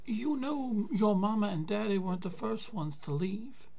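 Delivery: put-on old grandpa voice. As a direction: voice the weary grandfather. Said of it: "he says".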